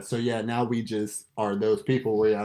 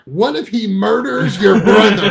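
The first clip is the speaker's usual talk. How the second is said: mock outrage; 8 semitones higher, 9 dB louder than usual. Mock extreme hypothetical, laughing.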